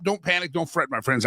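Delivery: ordinary talk, nothing marked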